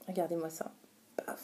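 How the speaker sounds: breathless whisper of amazement; awed whisper. Real, not acted. Whispering to viewers over a stunning swatch, awed. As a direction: hush it like showing a treasure.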